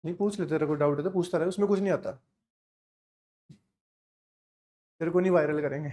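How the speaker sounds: low, soft side remark to a student; quiet aside. Real, not acted. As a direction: drop the voice and reassure one student off the lecture.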